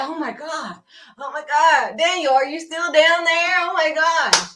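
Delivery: a king freaking out over the lions' den; panicked king. Acted. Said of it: "the king freaking out".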